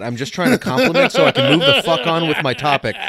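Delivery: exasperated laughing rant. Real, not acted. Exasperated at being interrupted.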